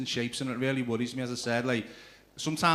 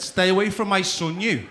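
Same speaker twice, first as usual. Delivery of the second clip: raised, loud confrontation; stern warning; 6 semitones higher, 7 dB louder than usual. Retold or imitated. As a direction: warn the kid off your son. Acts out confronting a child.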